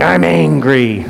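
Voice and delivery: mock yell. A kid in group therapy starts voicing his anger, mild mock yell. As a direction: imitate a kid starting to yell, not yet deeply felt. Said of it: "just a kid yelling".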